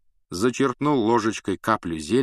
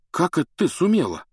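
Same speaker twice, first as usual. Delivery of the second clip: surprised; 9 semitones higher, 4 dB louder than usual. Acted. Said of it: "удивился Рон".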